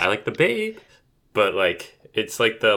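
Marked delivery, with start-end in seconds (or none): 0.3-0.8 sad puppy babe. Shown as a demonstration. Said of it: "like a little sad puppy babe".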